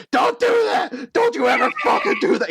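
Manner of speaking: very high, loud hysterical shriek; tearful screaming. Acted. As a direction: scream at them on the verge of tears. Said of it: "tears are, like, coming out of his eyes".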